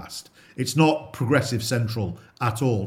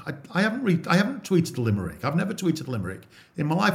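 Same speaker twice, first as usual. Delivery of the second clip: higher, quick, disbelieving protest; incredulous denial; 7 semitones higher, faster than usual. Retold or imitated. Retold protest to the officer about a limerick, high and incredulous. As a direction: bristle at the accusation, voice up high.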